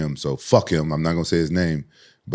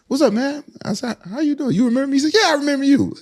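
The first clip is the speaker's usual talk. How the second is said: high quoted greeting. Retold or imitated. Quoting greeting with Randleman.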